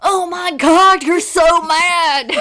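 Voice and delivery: slow, high, very loud mock yell; all-caps shout. Co-host mocks angry emailer in all caps, drawn-out shouting. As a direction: holler it with drawn-out mocking glee. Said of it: "I can write in all caps too".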